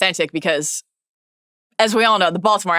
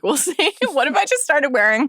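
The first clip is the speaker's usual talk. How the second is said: laughing; 7 semitones higher than usual. Real, not acted. Laughing about own hairstyle and hats.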